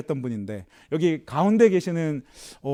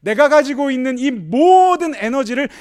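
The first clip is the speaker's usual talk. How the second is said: slow, much higher and louder declaration; solemn proclamation; 7 semitones higher, 7 dB louder, slower than usual. Acted. Preacher voicing the lion's vow, a slow loud proclamation.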